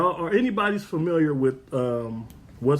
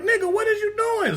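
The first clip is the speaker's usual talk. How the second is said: high shocked call. Retold or imitated. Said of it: "I go over there like".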